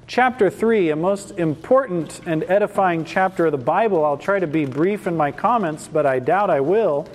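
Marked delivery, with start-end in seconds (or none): none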